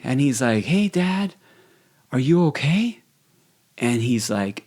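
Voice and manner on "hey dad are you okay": high-pitched imitation of a child; young son. Son checking on his dad at night, high child voice. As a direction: ask it in a high little-kid voice. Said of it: "his son walking in the room".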